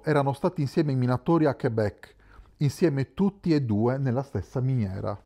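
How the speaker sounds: ordinary delivery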